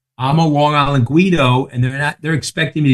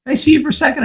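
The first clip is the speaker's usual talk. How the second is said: much higher, overly sweet ask; partner's request; 13 semitones higher, much faster than usual. Retold or imitated. Goldman partner asking him aside, very high. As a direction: lift it high like a boss asking a favour. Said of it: "He says".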